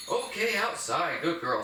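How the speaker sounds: slow, high-pitched cue with "outside" drawn out; high, slow praise, "outside" made to stand out; emphasis on outside. Shown as a demonstration. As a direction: stretch "outside" clearly for the dog. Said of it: "I'm gonna emphasize the word outside".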